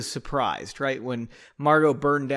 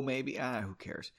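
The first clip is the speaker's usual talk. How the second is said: usual speaking voice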